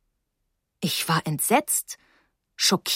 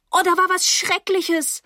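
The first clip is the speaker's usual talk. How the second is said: put-on high, distressed girl's voice; high-pitched frantic report; panicked; 11 semitones higher than usual. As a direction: blurt out the emergency in alarm. Frantic girl reports emergency.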